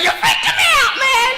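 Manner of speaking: very high, loud mock-hysterical shriek; high-pitched hammed-up freak-out; freaked-out girl. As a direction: squeal in comic panic as the girl. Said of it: "she's like".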